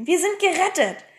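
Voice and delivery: high-pitched cry of relief; calling out. A narrator's reading. Pippi tells her friends they are saved, calling out. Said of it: "rief Pipi".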